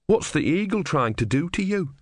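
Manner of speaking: put-on simple, wide-eyed young monk; naive young novice. Brother asks.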